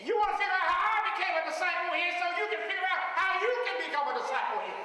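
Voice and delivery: high, loud, fast shouted retort; defiant healed man. Acted. Healed man shouting at Pharisees who question him. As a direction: throw it back at the questioners.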